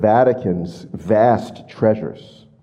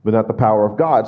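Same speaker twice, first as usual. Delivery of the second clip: ordinary talk, nothing marked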